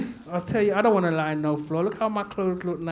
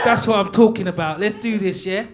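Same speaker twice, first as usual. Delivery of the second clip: hyped up; 3 semitones higher, 8 dB louder than usual. Real, not acted. Hyping the crowd after cheers.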